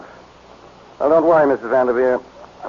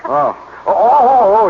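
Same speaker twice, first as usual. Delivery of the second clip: oh oh oh, higher and louder, caught out; suddenly realizing who's on the line; flustered surprise; 7 semitones higher, 4 dB louder, slower than usual. Acted. Inspector realizing the caller is important, flustered surprise.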